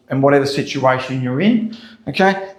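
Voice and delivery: usual speaking voice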